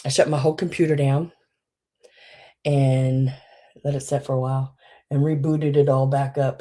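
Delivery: ordinary talk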